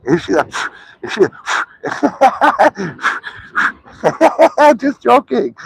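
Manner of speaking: laughing up high, squeaky and slow; high squealing laughter. Real, not acted. Owner laughing at dog startled by blowing, high giggle.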